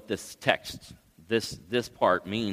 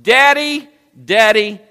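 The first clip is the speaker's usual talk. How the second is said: very loud, high-pitched plea; acted cry of a child calling for a father; 12 semitones higher, 13 dB louder than usual. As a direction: call out desperately, high and sustained. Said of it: "He cried out".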